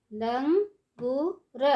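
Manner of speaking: Langur stretched slowly, syllable by syllable; slow syllable drill. Shown as a demonstration. Teacher drawing out langur slowly for children. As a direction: draw out langur for the class. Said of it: "ऊंची आवाज़ में कैसे पढ़ेंगे".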